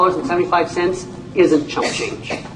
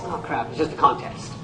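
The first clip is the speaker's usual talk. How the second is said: mock dismissive; 3 semitones lower, 8 dB quieter than usual. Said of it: "you go like this".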